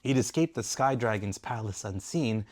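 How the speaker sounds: ordinary delivery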